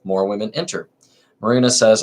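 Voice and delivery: usual speaking voice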